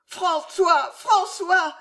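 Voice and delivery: high, loud frantic scream; delirious scream. A narrator's reading. Jeanne screaming to François, delirious. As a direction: scream his name in delirium. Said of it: "hurla Jeanne délirante".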